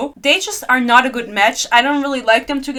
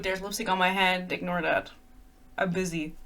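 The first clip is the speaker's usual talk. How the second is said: quiet aside; 4 semitones lower, 10 dB quieter than usual. Real, not acted. Aside about lipstick on hand.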